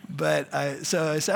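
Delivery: usual speaking voice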